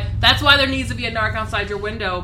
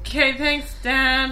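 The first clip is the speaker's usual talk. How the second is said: higher, louder snarky thanks; sarcastic teen; 5 semitones higher, 5 dB louder, slower than usual. Retold or imitated. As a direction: roll your eyes at Dad.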